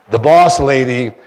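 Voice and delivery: ordinary talk, nothing marked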